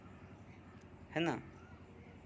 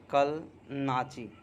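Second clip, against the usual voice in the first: ordinary talk, nothing marked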